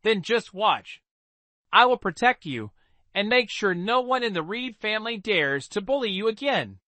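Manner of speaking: put-on steady, resolute young hero voice; firm promise. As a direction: promise it firmly and calmly. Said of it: "spoke each word firmly".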